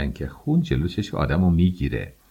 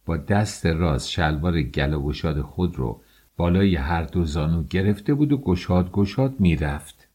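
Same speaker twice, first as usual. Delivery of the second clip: plain reading voice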